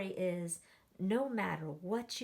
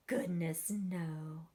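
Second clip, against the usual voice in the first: plain reading voice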